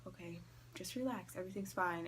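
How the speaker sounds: soft self-soothing murmur; retold attempt at calming down, hushed. Talking themselves down on the bed, quiet self-soothing.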